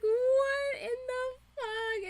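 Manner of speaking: high, drawn-out, slow shocked question; a slow, high, aghast question; stunned disbelief. Retold or imitated. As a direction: stretch it out in disbelief. Retold shocked reaction.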